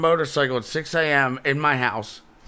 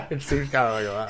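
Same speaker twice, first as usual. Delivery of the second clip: laughing; 2 semitones lower, 4 dB quieter, faster than usual. Real, not acted. Laughs at scary rehab idea.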